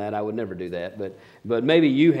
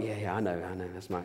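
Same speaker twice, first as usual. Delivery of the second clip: low, soft aside; imitated low-key reply; 7 semitones lower, 12 dB quieter than usual. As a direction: mimic a mother quietly brushing it off. Mother's brushed-off reply, retold.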